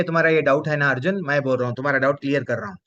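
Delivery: ordinary talk, nothing marked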